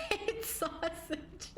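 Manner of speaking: high, slow, breaking into laughter; laughing. Real, not acted. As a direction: crack up through the words. Cracking up while teasing chat.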